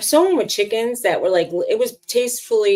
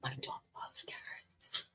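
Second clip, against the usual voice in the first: whispered so the dog won't hear "carrots"; 21 dB quieter, slower than usual. Real, not acted. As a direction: hide the word from the dog, whispering. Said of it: "Don't say carrots".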